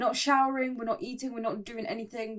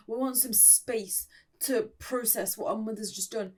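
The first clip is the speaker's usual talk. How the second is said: ordinary talk, nothing marked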